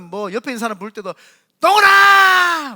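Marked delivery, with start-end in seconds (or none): 1.6-2.7 loud, high, slow drawn-out yell of "동훈아"; yelling a name. Retold or imitated. A rock-loving spouse bellowing across the house, loud yell. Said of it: "이렇게 부르지".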